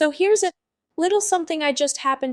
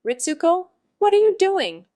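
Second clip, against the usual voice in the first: higher, louder call across the street; 6 semitones higher, 5 dB louder than usual. A narrator's reading. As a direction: shout to a friend who fell behind. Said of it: "your friend who's with you shouts out to you".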